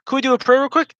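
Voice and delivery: high, mock Madea asking to pray. Friend imitating Madea, high and mock. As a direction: squeak it out like Madea. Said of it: "I was watching Madea".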